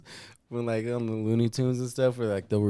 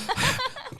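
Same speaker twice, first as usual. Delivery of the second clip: high burst of laughter; 3 dB quieter than usual. Real, not acted. Laughs at the joke.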